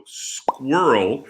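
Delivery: "squirrel" slowly as "skwurl"; drawn-out SQUIRREL. Shown as a demonstration. Teacher listing words like world, drawn-out pronunciation. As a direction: stretch the "url" in "squirrel". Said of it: "that's the same sounds".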